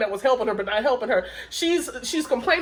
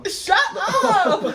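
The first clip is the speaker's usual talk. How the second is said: laughing, higher "Shut up!"; mock shout through laughter; 8 semitones higher than usual. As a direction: toss it off mid-laugh, pitched up. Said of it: "she was just like".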